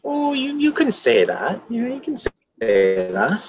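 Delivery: put-on Irish lilt, rising at the ends; lightly performed Irish accent; attempted Irish accent. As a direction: answer in character with an Irish lilt. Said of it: "I picked up the accent".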